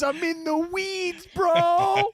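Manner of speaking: very high, drawn-out holler; mock frantic kitchen call. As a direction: wail it across a swamped kitchen. Re-enacts frantic restaurant kitchen call.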